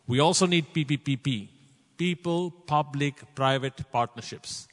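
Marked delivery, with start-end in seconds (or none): 2.0-4.7 people, public, private, each word slow and separate; stress on people. Real, not acted. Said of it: "I'm talking about people".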